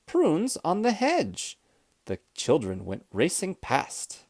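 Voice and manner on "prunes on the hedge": put-on high, drawn-out children's call; excited shout. A narrator's reading. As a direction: call it out slowly, high-pitched. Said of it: "they shouted".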